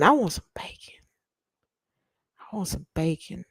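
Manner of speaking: whispered then very soft bacon wish; hushed longing for bacon; craving whisper. Pretend. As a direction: breathe it like a secret craving.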